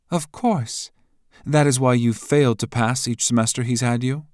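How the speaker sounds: high, loud, taunting woman's voice; mocking rival. A narrator's reading. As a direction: needle him with scorn.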